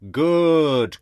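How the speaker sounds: loud sarcastic "Good!" shouted out; mock cheer.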